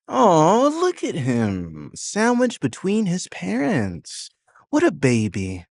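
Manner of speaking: high, sing-song mock pity. A narrator's reading. Quinn taunting Andrew between his parents, high mocking coo. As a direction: coo at him like a baby to humiliate. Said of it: "Quinn says".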